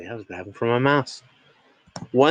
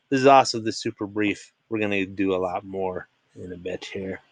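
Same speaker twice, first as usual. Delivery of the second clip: normal talking voice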